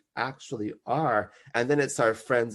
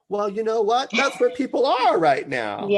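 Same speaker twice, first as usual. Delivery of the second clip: emphatic; 10 semitones higher, 6 dB louder than usual. Real, not acted. Emphatic rebuttal to critics.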